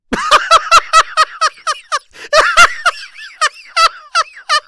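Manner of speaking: very high, loud machine-gun laughter; explosive belly laugh. Shown as a demonstration. Guest shows the host his laugh, explosive belly laughter. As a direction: burst straight into long, full-throttle laughing.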